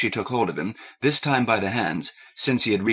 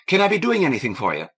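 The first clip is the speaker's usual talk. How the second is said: hurried eager; 7 semitones higher, 4 dB louder, faster than usual. Acted. Kathleen eager to please.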